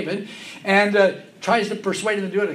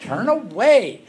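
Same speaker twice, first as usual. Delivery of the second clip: louder, drawn-out order; slow insistent exhortation; slow firm command; 7 semitones higher, 7 dB louder, slower than usual. Acted. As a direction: command them to turn away. Preacher's command.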